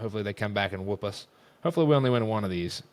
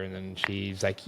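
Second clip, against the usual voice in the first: ordinary delivery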